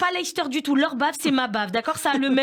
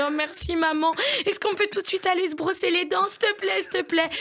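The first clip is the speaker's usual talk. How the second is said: put-on higher, pleading little-girl voice; imitating a begging child; 6 semitones higher than usual. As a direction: beg like an excited toddler. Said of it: "Elles m'ont dit". (fillette qui supplie, voix haute)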